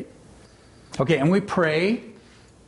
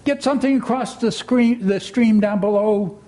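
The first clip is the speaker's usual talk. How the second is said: higher urgent call; 6 semitones higher than usual. A narrator's reading. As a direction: shout instructions downstream. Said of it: "called Rabbit".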